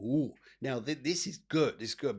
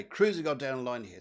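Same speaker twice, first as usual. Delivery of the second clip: ordinary delivery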